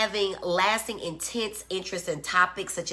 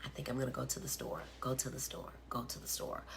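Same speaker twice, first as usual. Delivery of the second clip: much softer, fading repeats like an echo; faint ghostly echo dying out; 4 semitones lower, 13 dB quieter, faster than usual. Shown as a demonstration. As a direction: echo it back, each repeat fading further away. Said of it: "you hear someone".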